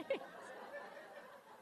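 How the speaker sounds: high breathy burst of laughter; amused unvoiced laugh; real laugh. Real, not acted. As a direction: laugh at herself. Laugh after recital.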